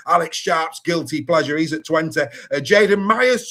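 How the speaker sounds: usual speaking voice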